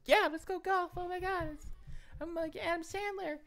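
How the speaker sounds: put-on high-pitched squeal of a thrilled kid; excited kid. Storyteller as his younger self invited to golf, excited kid.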